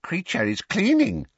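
high, dutiful elf echo; repeated elf reply. A narrator's reading. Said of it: "the elf repeated".

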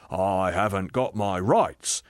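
slow, insistent complaint; aggrieved ghost. Acted. Ghost demanding his rights, slow and indignant. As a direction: drag out the grievance. Ghost's take of the same words.

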